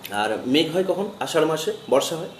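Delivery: ordinary delivery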